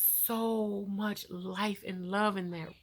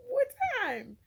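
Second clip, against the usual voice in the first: surprised. Retold or imitated. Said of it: "And I'm like".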